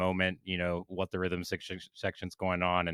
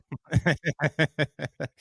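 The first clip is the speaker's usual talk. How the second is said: amused laugh; 8 semitones higher, 5 dB louder than usual. Real, not acted. Laughs at the raspy voice.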